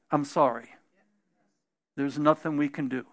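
a hint of a doctor breaking bad news; hushed bad report; somber doctor.

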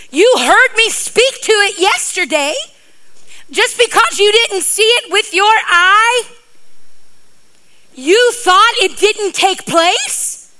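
Jesus rebuking Peter for doubting; exasperated rebuke. Retold or imitated. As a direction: scold the disciple in disbelief. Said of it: "I think that he was going".

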